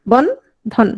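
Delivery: "Bandhan" in two beats, "বন" then "ধন"; split into syllables. Shown as a demonstration. Teacher repeating the division for class, Bandhan split into syllables. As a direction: pause inside "Bandhan" after "বন". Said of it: "কয় ভাগে বললাম".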